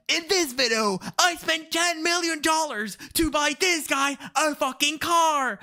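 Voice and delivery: high, hyped MrBeast-style intro; YouTuber voice. Retold or imitated. Imitating a hyped YouTuber opening a video, high and punchy. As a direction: sell it like a big YouTube intro. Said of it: "the YouTuber voice".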